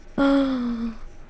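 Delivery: high, shocked gasp; dismayed gasp. Real, not acted. Artist spots the scratch on her tablet, dismayed gasp.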